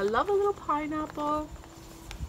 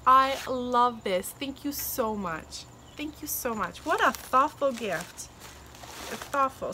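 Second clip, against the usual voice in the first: normal talking voice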